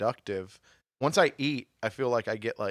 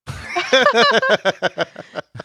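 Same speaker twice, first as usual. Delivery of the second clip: big laugh; 11 semitones higher, 8 dB louder than usual. Real, not acted. Laughs at own dog joke.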